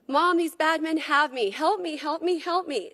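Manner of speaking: acted-out plea for help; retold desperate pleading. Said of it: "She begged and pleaded".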